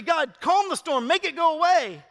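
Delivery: loud, high-pitched begging prayer; urgent plea. Retold or imitated. Believer praying to God in a storm, loud urgent plea. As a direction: cry out to God for rescue.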